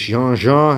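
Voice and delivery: "Jean Jean" with a French zh sound, nasal vowel; French word. Shown as a demonstration. Telling clients the French name of the fabric, French pronunciation. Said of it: "In French".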